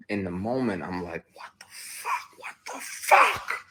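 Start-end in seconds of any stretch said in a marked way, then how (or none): 1.3-3.7 slow, breathless whispered disbelief; stunned whisper. Retold or imitated. Frozen after the guy grabs him, slow stunned whisper.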